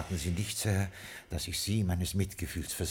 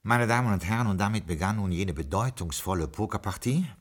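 story narration. A narrator's reading. Butler turns to the listeners to narrate, storyteller voice. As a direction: lead the listeners into the tale.

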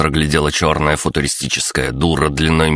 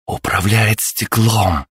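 amazed whisper; 7 semitones higher, slower than usual. A narrator's reading. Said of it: "уловил шёпот Натальи".

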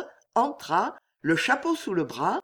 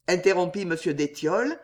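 ordinary delivery

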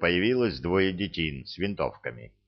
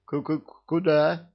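terrified, stammering high; frightened stutter; 8 semitones higher than usual. Acted. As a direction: stutter in panic. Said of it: "Совершенно одуревший от страха".

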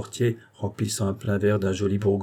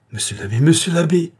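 raised, higher cry of alarm; cried out; 8 semitones higher, 7 dB louder than usual. A narrator's reading. Aunt Angélique crying out to the abbé in alarm. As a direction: cry out his title in alarm. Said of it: "s'écria tante Angélique".

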